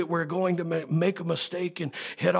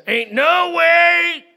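slow, much higher and louder mock refusal; defiant shout; 13 semitones higher, 14 dB louder, much slower than usual. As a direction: yell the refusal, hammed-up. Mock stubborn reply to God.

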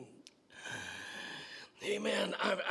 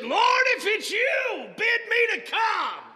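shouted plea; 12 semitones higher, 8 dB louder than usual. Acted. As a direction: call out across the water. Peter calling out from boat.

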